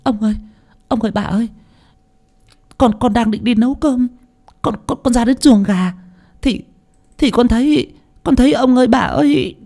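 high, stuttering frightened servant voice; terrified stammering maid. Acted. Maid Mơ stammers to her masters in high frightened voice. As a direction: stammer it out, shaking with fear.

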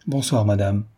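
plain reading voice

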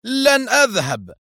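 slow high furious no; angry refusal. A narrator's reading. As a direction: refuse flatly in fury. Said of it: "قال آرثر في غضب".

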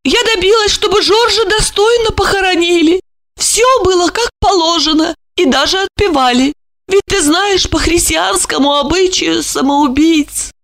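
high hot-tempered female voice; heated. A narrator's reading. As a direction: defend yourself with fervour. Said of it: "горячась, воскликнула".

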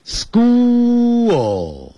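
"school" stretched very long and high, falling into a full L; exaggerated final L. Shown as a demonstration. English teacher demonstrates the L for listeners, exaggerated pronunciation. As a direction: draw out "school", ending tongue-up on the L. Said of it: "舌尖要抵上去".

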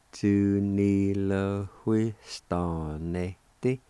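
"Tu ni le hui sta ne te" at half speed; slow sounding-out. Shown as a demonstration. Teacher showing how jobs is pronounced, slow sounding-out. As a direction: stretch each syllable of Tu ni le hui sta ne te. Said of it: "like this".

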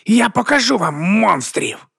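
high, slow, drawn-out cry; thundering threat. A narrator's reading. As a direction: declare it menacingly to the crowd. Bishop keeps thundering at crowd.

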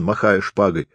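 ordinary delivery

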